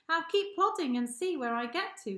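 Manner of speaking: hippo voice. Acted. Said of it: "he said".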